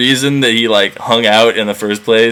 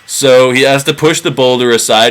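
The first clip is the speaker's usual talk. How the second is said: normal talking voice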